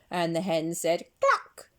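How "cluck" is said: high loud cluck; hen cluck. Acted. The hen speaks.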